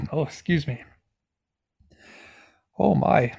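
coughing fit, choking on myself. Real, not acted. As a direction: struggle through a choking fit. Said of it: "choking on myself".

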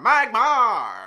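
high loud creature growl; Magmar cry. Pretend. Cohost does her Magmar impression, high and loud. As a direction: bellow the name like a fire Pokemon. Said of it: "Here's my impression of Magmar".

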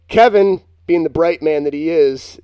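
mock praise, opening high and loud; sarcastic. Real, not acted. Podcast host ribbing his friend's bright idea, sarcastic. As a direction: punch the name, then praise him sarcastically. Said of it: "and I say that sarcastically".

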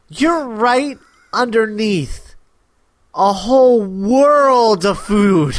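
drawn-out, spelled-out exasperation; slow, emphatic. Real, not acted. Giving a hint to the Atlanteans, slow and emphatic. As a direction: spell it out very slowly.

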